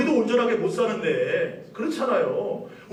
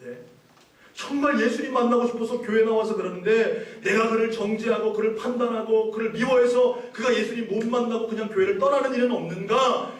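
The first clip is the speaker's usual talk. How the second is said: normal talking voice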